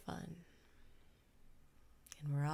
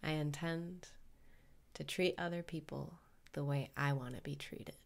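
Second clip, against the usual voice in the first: calm guru voice leading the breathing; guided meditation. Acted.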